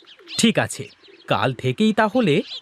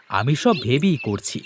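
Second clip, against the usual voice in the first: normal talking voice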